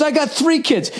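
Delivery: high mocking voice of the driver; smug driver. Rude driver voiced in story.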